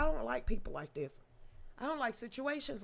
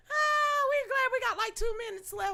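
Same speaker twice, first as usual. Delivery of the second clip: much higher, relieved; higher excited relief near the end; 12 semitones higher, 5 dB louder than usual. Real, not acted. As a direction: cheer that it's almost over. Excited ending soon.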